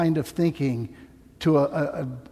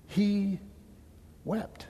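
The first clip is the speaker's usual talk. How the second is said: hushed solemn; 6 semitones higher, 7 dB quieter than usual. Real, not acted. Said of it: "solemn key line".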